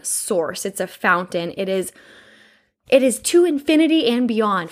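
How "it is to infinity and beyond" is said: heroic space-ranger catchphrase, a bit higher; Buzz Lightyear. Pretend. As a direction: announce it like a toy space hero. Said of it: "Fucking Buzz Lightyear".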